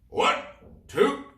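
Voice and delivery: high, loud pirate roar. Acted. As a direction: roar the count to the crew. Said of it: "he roared".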